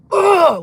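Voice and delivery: loud, high exasperated ugh; imitated groan of frustration, shouted out; frustrated roar. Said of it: "Like, he got frustrated with me".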